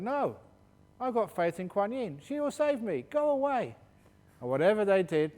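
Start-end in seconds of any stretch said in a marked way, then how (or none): 0.0-3.7 much higher, louder stubborn monk; defiant refusal. Retold or imitated. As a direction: shoo them off in a high insistent voice.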